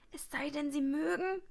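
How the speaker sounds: ordinary delivery, nothing marked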